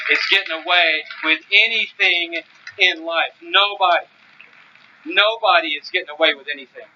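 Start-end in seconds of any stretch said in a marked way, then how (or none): none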